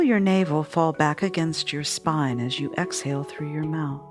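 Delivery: calm, steady meditation guide; guided meditation. Acted. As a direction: lead them gently through the breathing. Leads a meditation.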